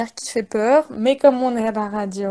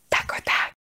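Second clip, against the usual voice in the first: dramatic whisper; 8 dB quieter than usual. Acted. Dramatic game title announcement.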